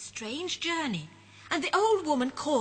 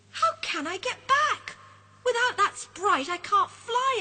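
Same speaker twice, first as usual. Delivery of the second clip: higher, worried moan; dismayed moan; 11 semitones higher, 6 dB louder than usual. Acted. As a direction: fret about getting home. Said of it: "he moans".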